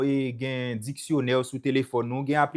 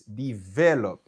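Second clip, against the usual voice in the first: Develop as de-VE-lop; stress on capitalised syllable; 4 semitones lower, slower than usual. Shown as a demonstration. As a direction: emphasise VE in Develop, then fall. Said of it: "se sou li ke ou fonse plis".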